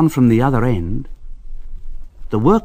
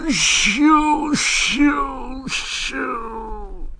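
fretful engine; much slower than usual. A narrator's reading. Henry afraid of the tunnel, high whimpering. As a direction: wail shoo, dying away.

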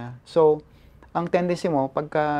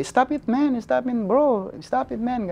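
higher-pitched retold protest; imitated bystander plea; 8 semitones higher, slower than usual. Bystanders calling to the attacker to stop, imitated urgent plea. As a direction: urge them to stop, voicing onlookers.

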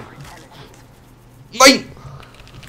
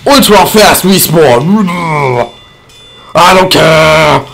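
slow mock announcer; slower than usual. Player announcing his respawn in a slow mock-announcer voice.

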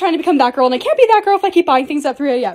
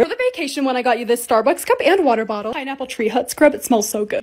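ordinary talk

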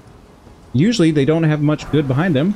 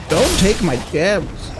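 slow, high protesting voice; mock a creature guarding its treasure; drawn-out, high put-on plea; imagined creature voice; 8 semitones higher, slower than usual. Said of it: "this guy's like".

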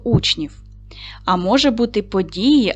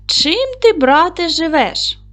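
high, louder friendly dog voice; dog character; 8 semitones higher, 4 dB louder than usual. Acted. Teacher reading the tale, dog greets the wolf in high voice. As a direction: pipe up high as the curious dog.

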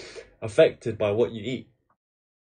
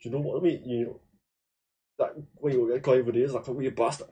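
put-on Scottish accent; 5 dB quieter, faster than usual. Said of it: "when they're talking to me, like".

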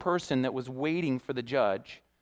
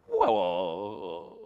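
stunned; 8 semitones lower than usual. Retold or imitated. Retold stunned reaction to the charge.

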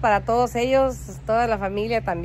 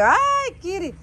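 cooing at the cat; 9 semitones higher, 3 dB louder, much slower than usual. Retold or imitated. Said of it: "Mira, mírale".

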